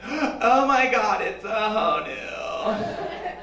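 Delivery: slow, awestruck tourist gasping over a turtle; breathless mock wonder at the honu; gushing tourist lady. Mocking a tourist lady gushing over a turtle, slow and awestruck. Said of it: "She's like".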